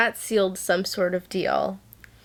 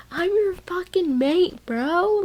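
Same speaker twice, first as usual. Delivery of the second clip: higher, hammed-up dudebro declaration; mock bro voice; 11 semitones higher, 4 dB louder than usual. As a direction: imitate him like a pushy bro. Retells Cassian's confession mockingly.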